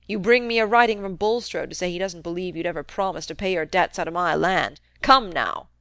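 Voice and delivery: put-on a crusty old man needling his nephew, loud; gruff old uncle. Old uncle goading nephew Fred about the rumour, crusty taunt. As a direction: jab at him like a sly old miser.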